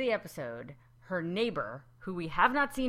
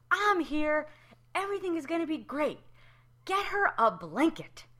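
high, loud hammed-up rescuer; husband arriving at the birth, hammed-up hero; mock heroic husband; 10 semitones higher, 5 dB louder than usual. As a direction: play the husband bursting in to save the day. Husband rushes in, voiced.

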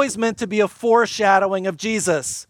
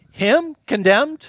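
incredulous refusal; 4 semitones higher than usual. Pretend. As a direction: scoff at the very idea, then push it away. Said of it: "what Jesus says to you".

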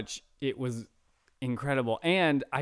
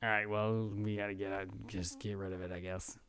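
much softer, lower, trailing away; mock resigned shrug; 4 semitones lower, 10 dB quieter than usual. Movie fan imitating characters ditching the God's Eye, resigned. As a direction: toss it off as a half-hearted quote. Acting out characters' offhand dismissal.

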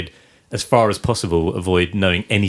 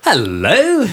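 high silly greeting; 9 semitones higher, 4 dB louder than usual. Pretend. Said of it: "What would be an appropriate voice for Hereditary".

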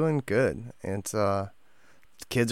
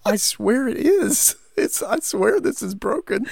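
pleading through laughter; 7 semitones higher than usual. Retold or imitated. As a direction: swear it's broken while cracking up. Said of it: "And you're looking at this dumpster fire like".

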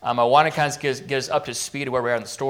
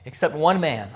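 ordinary delivery

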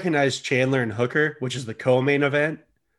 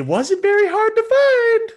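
very high, slow, louder squeak; squeaky falsetto; 6 dB louder, slower than usual. Acted. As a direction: squeak it out in a silly high voice, drawn out. Comic falsetto aside.

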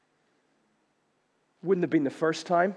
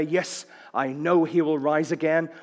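firm, earnest declaration of belief; wholehearted, confident affirmation; faith-filled. Acted. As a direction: say it like you truly believe it. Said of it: "she says it in a very faith-filled way".

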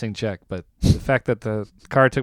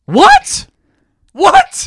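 high, loud disbelieving What; shocked yell; 10 dB louder than usual. Real, not acted. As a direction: shout it in total shock. Shocked by the joke-thief news.

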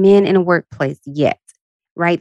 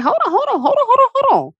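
"hold on" yelped in a far higher frantic voice; mock panic. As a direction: squeal as if the pump is running away. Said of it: "I'm like".

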